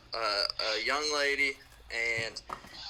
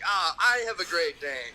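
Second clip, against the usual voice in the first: fibbing salesman; 7 semitones higher than usual. Retold or imitated. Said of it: "I might fib a little bit and be like".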